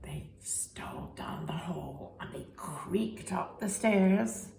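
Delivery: soft whispered storytelling. A narrator's reading. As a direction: whisper so the farmer won't wake. Creeping into house.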